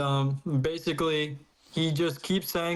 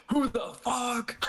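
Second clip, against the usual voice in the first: high, drawn-out bafflement; exaggerated appalled question; mock outrage; 8 semitones higher, slower than usual. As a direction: act out indignant disbelief, stretched. Acting out an outraged chat message.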